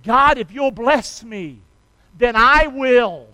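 loud, slow, emphatic vow to God; earnest vowing prayer. Acted. Preacher acting out Jabez's vow to God, earnest and emphatic. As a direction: stretch out each half of the bargain. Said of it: "that's the kind of prayer he prayed".

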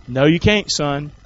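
ordinary talk, nothing marked

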